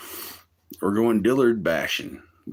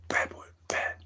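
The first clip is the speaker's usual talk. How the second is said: much softer, breathy scold; whispered scolding; 7 dB quieter than usual. Real, not acted. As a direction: whisper it like a reprimand.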